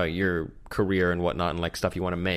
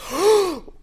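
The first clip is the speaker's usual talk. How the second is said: high sharp gasp; 3 dB louder than usual. Real, not acted. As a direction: gasp as it comes back. Sudden remembered anecdote.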